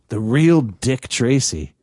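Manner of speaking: slow and deliberate; slow prank repeat. Retold or imitated. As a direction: spell it out slowly like a smug prankster. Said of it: "prank caller repeats".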